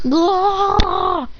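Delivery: high drawn-out "glaah"; imitated scream. Two rivals suffering their own cure, high mock scream. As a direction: cry out high in cartoon agony. Pouring it in eyes.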